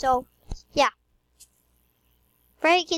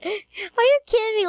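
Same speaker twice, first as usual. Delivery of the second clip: outraged gasp; 9 semitones higher than usual. Real, not acted. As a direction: gasp then cry out in disbelief. Mock outrage at sister.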